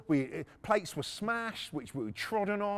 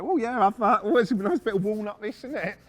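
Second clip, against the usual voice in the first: antiques appraiser; 9 dB louder than usual. Retold or imitated. Mate imitating the boss admiring furniture, mock appraiser. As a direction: play the fussy Antiques Roadshow expert. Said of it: "like fucking Antiques Roadshow".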